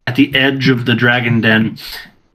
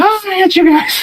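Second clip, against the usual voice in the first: squeaky mock complaint, much higher; high whiny imitation. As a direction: parrot the whine in a squeaky voice. Player echoes Baril's whine.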